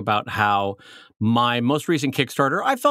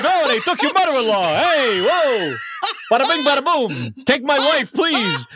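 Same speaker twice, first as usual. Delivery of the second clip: high-pitched hammy fifties comedian; Catskills comic; 10 semitones higher than usual. Acted. Co-host mocking the mother-in-law joke, hammy Catskills comic. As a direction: hammer out corny old punchlines. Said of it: "send you to the Catskills".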